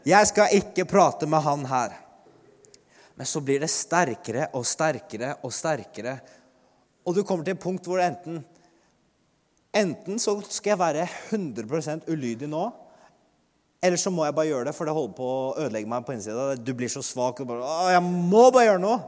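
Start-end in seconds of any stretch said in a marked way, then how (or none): 0.1-2.0 louder flustered protest to God; panicked refusal. Retold or imitated. Afraid to approach a huge man, protesting loudly to God. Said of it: "jeg sier med en gang". 17.7-19.0 a loud exasperated cry; overwhelmed outburst. Retold or imitated. Overwhelmed by the urge to speak, crying out louder.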